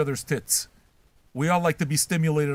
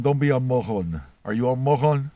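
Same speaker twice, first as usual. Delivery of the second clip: ordinary delivery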